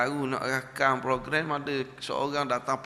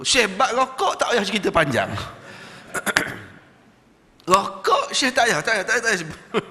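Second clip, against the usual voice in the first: higher, louder, hurried begging; pleading smoker; 6 semitones higher, 5 dB louder than usual. Retold or imitated. Smoker begging the Syekh to skip the advice, loud and high. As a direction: beg him not to start the lecture.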